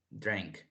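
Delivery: 'drank' with an E-like vowel, drenk; correct pronunciation demo. Shown as a demonstration. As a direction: say 'drank' as if spelt with E. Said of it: "como con una E". ('drank' pronunciado como con una E)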